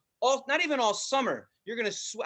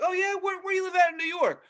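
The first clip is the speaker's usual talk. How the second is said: challenging New Yorker; 9 semitones higher, faster than usual. Retold or imitated. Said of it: "Be like".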